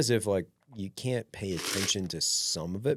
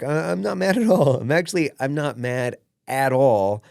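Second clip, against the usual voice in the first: louder and higher, insisting he's not mad; hammed-up loud insistence; mock loud denial; 6 semitones higher, 9 dB louder than usual. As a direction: protest too much, slowing down at the end.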